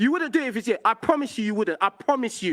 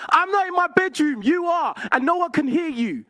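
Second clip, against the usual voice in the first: raised, high-pitched argumentative voice; heated retort; 6 semitones higher than usual. Real, not acted. Caller firing back at his opponent in a quarrel, heated. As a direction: throw it back at them, voice pitched up.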